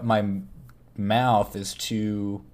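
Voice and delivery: ordinary talk, nothing marked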